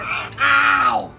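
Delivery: high, loud, real yelp of pain. Real, not acted. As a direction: yelp as you get bitten. Bitten by the macaw.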